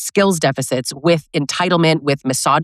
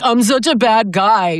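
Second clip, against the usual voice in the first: higher, slow whiny bad-guy act; mock self-pity; 5 semitones higher than usual. Host mocks an abuser's self-pity, higher and slow. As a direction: drag out the fake guilt. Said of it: "I'm hearing like".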